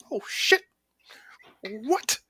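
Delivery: high, hushed mock shock; startled whisper. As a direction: whisper it like a startled little kid. Voicing the startled youngling.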